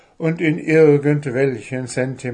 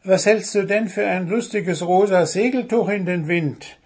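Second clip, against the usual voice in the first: high, cheerful goblin voice; high merry goblin question; 8 semitones higher, 3 dB louder than usual. Acted. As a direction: call out gaily over the fence. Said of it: "fragte Wuschel fröhlich".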